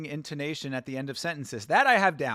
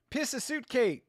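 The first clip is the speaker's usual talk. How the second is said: put-on "Piss a suitcase" in a New Zealand accent, higher; Kiwi accent; 8 semitones higher than usual. Joking the Kiwi says "Piss a suitcase", New Zealand accent. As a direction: mangle "fill a suitcase" the Kiwi way. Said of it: "speak with a New Zealand accent".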